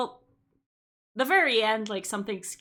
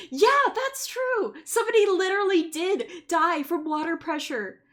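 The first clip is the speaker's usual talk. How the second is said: high, loud vindicated outburst; high-pitched emphatic exclamation; indignant agreement; 8 semitones higher, 6 dB louder than usual. Real, not acted. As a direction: seize on the point and protest loudly. Indignant agreement with viewer's point.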